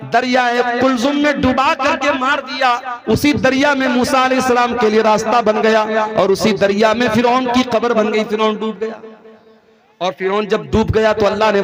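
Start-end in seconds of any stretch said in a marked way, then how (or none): none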